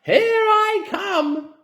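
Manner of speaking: very high, loud, slow screech; Grandma screeching triumphantly as she grows; screechy old hag. A narrator's reading. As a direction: crow it out like a mad witch. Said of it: "she shouted".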